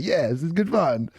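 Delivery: carefree Romanians. Retold or imitated. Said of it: "The Romanians like".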